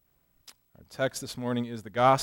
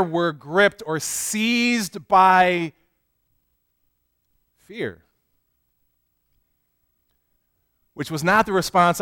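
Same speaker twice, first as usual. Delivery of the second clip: fervent sermon, louder and higher; preaching; 7 semitones higher, 12 dB louder than usual. Acted. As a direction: build the sermon with conviction. Preaches with rising force.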